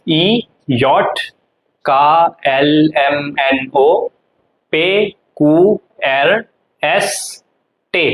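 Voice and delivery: very slow, letter by letter; alphabet recitation. Shown as a demonstration. Teacher starting the alphabet over, slow spaced recitation. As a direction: recite each German letter separately, drawn out. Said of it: "तो start करते दोबारा से".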